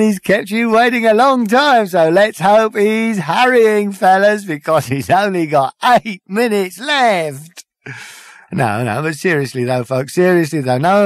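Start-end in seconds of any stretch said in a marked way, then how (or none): 0.0-7.7 lightly voiced showbiz host; host working the audience with mock cheer; cabaret compere. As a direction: play the smarmy stage host to the crowd. Compere's stage patter.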